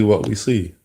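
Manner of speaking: ordinary delivery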